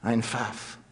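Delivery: a contemptuous German snort of a word. A narrator's reading. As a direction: spit it out with scorn. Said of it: "the commandant snorted".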